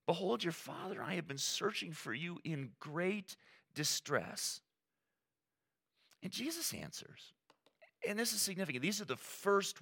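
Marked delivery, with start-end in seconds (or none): 0.0-4.6 lightly voiced upset mother, rising high; a hint of a worried mother scolding; frustrated mother. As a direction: plead with a hurt, exasperated edge. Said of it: "she's even more frustrated".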